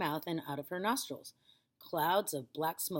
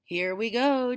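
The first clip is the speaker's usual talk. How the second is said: louder, higher eager call; boy calling out gleefully; cried out; 6 semitones higher, 8 dB louder than usual. A narrator's reading. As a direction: cheer her on. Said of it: "George cried out".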